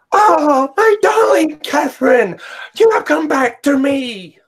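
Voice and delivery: very high, loud, swooning declaration; over-the-top vampire. Retold or imitated. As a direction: gush it out like a theatrical lovesick vampire. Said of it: "reenact how he acts".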